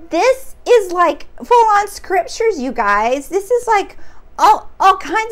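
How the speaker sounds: thrilled, almost squealing with discovery; excited. Real, not acted. Telling viewers the film is like scripture, thrilled.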